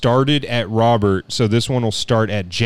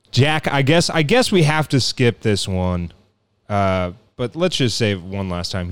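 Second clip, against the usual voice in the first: normal talking voice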